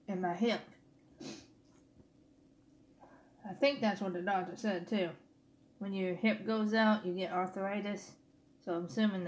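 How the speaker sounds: eating between words; talking while chewing. Real, not acted. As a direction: mouth partly full. Seen 3: fork repeatedly at mouth, eating throughout labelled words; mouth partly hidden.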